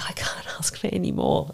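soft, unvoiced, hushed; whispered gratitude. Telling the host what she felt holding the baby, a whisper. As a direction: breathe it out, overwhelmed.

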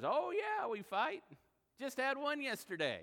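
a hint of a feisty old husband owning up; old man's voice. Preacher voicing a ninety-year-old husband, lightly hammed-up old man. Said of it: "the ninety-year-old man".

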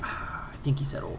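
unvoiced whispered aside. Real, not acted. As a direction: whisper it under your breath.

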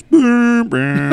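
mock scanner alarm; imitated scanner boom. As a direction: bark out the scanner tone like a radio squawk. Scanner noise imitated in story.

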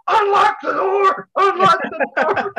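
loud, high, slow desperate yell; Dad shouting through the window, desperate and pleading; frantic screaming. Retold or imitated. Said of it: "running around the house screaming".

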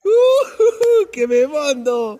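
high laughing shriek into the words; can't hold the laughter in. Acted. Cat mocks the lion's roar. (risa a gritos, muy aguda)

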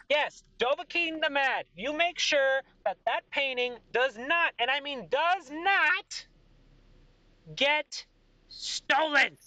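high, slow, insistent royal order; commanding king. Acted. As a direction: warn them with heavy emphasis. King gives stern order.

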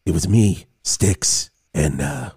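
low, gruff audiobook narrator; Alec Baldwin voice. Acted. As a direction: read it like a deep celebrity narrator. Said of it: "play a clip from Here's What Happened, uh, read by Alec Baldwin".

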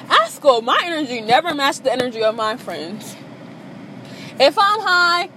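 normal talking voice

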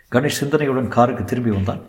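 ordinary delivery

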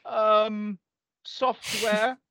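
put-on high, hesitant little-boy voice; boyish voice. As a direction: mumble it like a frightened kid. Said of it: "sort of really intimidated, like, boyish voice".

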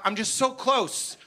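usual speaking voice

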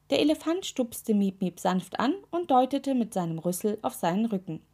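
usual speaking voice

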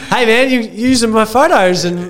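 lightly acted indignant caller; mock complaint. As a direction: act out a jokey confrontation at the door. Acts out [name] confronting them at door.

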